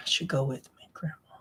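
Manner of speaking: soft unvoiced whisper to himself. Real, not acted. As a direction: breathe the words out almost silently. Sad aside about leaving.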